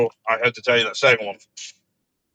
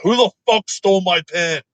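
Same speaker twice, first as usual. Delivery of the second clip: pitched-up, indignant retold yell; mock angry outburst; 7 semitones higher than usual. Worker demanding to know who took his pen, mock indignant. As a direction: act out the outrage over a stolen pen, higher.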